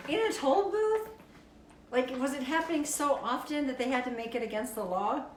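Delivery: high-pitched, loud disbelief; comic, high, shouted bafflement; loud incredulity. Real, not acted. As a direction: blurt it out in astonished outrage. Incredulous reaction to an absurd law.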